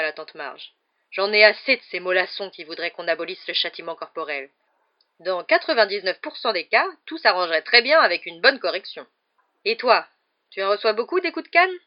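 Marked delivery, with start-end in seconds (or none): 1.1-9.1 loud, opinionated rant; booming, indignant tirade; blustering aunt. A narrator's reading. Aunt ranting about punishment. 9.6-11.7 loud, high pointed question; booming, prying demand; overbearing aunt. A narrator's reading. Aunt interrogating the boy.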